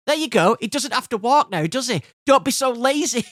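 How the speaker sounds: high, heated sarcastic scolding; exasperated. Retold or imitated. Inspector handing over the dog, exasperated and scolding. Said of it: "the inspector just lost it".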